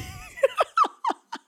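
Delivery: a sudden, very high laugh of disbelief; squealing burst of laughter; incredulous laugh burst. Retold or imitated. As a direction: crack up at the absurdity. Sister cries again at graduation.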